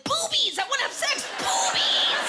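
very high yell, ending in a harsh shout; crude mock excitement. Men seeing a woman's body, hammed-up excited yelling. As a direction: holler like an overexcited caricature of men.

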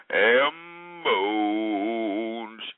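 low, hugely drawn-out word; mock-grand drawl. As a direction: stretch BONES long and low. Mock announcing of ham bones.

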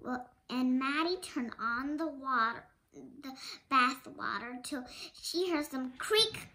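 usual speaking voice

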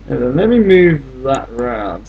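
ordinary talk, nothing marked

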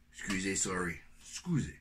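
ordinary delivery

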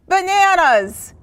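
high, loud, stretched-out whoop; excited shout. Acted. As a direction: belt it out like a thrilled monkey. Monkey breaks off the chant.

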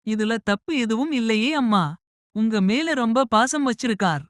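lightly voiced bride; young bride voice. Bride defends son.

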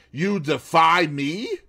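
indignant. A narrator's reading. Husband confronting his defiant wife, indignant. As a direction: demand it in wounded anger.

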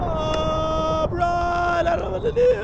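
high, cracking, near-crying wail; panicked breakdown. Real, not acted. Streamer hunted by the ghost, panicked high wail. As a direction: fall apart in real panic. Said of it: "I'm having a mental breakdown".

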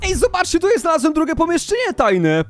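high-pitched eager announcement; excited discovery. Real, not acted. Player shows viewers a second secret room, high excitement. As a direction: call the viewers over, pitch way up.

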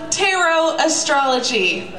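normal talking voice

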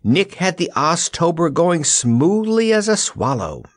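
high, projected report; calling out. A narrator's reading. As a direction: call it as if through cupped hands. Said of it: "making a megaphone of her hands".

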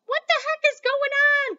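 put-on startled old woman's cry. Grandma Josephine, woken suddenly, crying out high and loud. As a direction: shriek it like a granny woken with a start. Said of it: "cried Grandma Josephine".

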